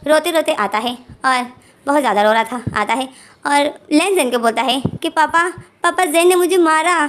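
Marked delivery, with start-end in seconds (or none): 5.1-7.1 high, louder whiny telling-on; a small kid tattling while upset; child tattling to dad, mildly whiny; crying child complaint. Retold or imitated. Child runs crying to father.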